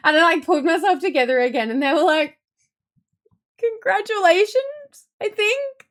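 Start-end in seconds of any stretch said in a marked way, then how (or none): 3.6-5.9 slow, high, hesitant congratulations; unsure coworkers. Retold or imitated. Coworkers congratulating her, puzzled and hesitant. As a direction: say it unsure whether it fits.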